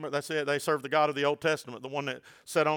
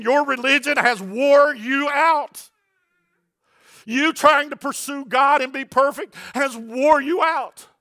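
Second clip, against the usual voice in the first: impassioned plea; 8 semitones higher, 7 dB louder than usual. Acted. Preacher voicing Jesus to the weary, tearful and loud. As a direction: preach it with a breaking voice.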